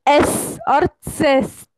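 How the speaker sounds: slow, spelled out एस और सेस; drawn-out letter name एस और सेस; German eszett. Shown as a demonstration. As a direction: say एस और सेस carefully, syllable by syllable. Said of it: "उसका pronunciation करते वक्त हमें कैसा करना होता है".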